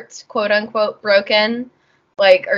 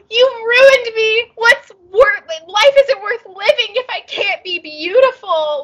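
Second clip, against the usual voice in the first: crybaby wail; 11 semitones higher, 4 dB louder than usual. Retold or imitated. Hal wailing to Sophie over his ruined hair. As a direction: wail it like a spoiled child in despair. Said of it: "He's like".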